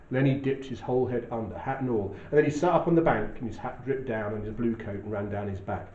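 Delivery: measured, even reading of a novel opening; steady literary narration voice; story narration. A narrator's reading. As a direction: read it like a storyteller setting the scene. Reads novel narration aloud.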